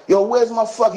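high-pitched retold demand; cut-off angry yell, imitated. Wounded [name] demanding money.

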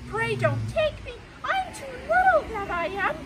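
high, loud, pleading little goat; pleading small goat. Acted. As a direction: beg the troll in a tiny high voice. Tiny goat pleads with troll.

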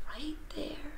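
very soft, breath-only whisper; hushed whisper. Real, not acted. Showing children the purple pebble, hushed whisper. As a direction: whisper it like a secret find.

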